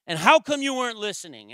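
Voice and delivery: loud, high-pitched dad yell; indignant parental outburst. Retold or imitated. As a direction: shout your denial at your child, then scold. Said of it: "raising my voice at my child".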